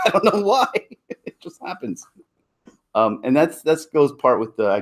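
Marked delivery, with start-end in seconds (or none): 0.0-2.1 high, helpless laughter through the words; laughing. Real, not acted. Speaker baffled by his Portuguese accent habit, laughing. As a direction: laugh while admitting it, voice rising and thinning out.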